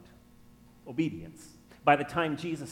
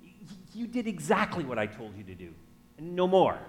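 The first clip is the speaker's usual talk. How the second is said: pointed, higher reproach, lightly acted; upset wife; 5 semitones higher, 5 dB louder than usual. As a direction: act out a mildly upset wife scolding. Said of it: "she's kind of upset".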